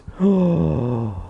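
big theatrical gasp; shocked gasp. Pretend. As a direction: gasp at the plot twist. Mock shock at plot twist.

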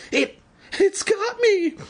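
very high, hammed-up panic; panicked stammer. A narrator's reading. Evan caught by the goo, high panicked stammer. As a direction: squeal it with a stammered start. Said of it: "Evan stammered".

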